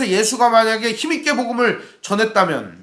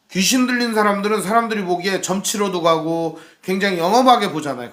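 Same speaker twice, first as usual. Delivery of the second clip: usual speaking voice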